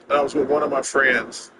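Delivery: ordinary talk, nothing marked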